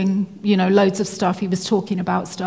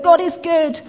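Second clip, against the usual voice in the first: higher-pitched imitation of a happy man; retold joyful exclamation; 8 semitones higher than usual. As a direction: quote his relieved praise, lifted high. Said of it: "he was just so happy".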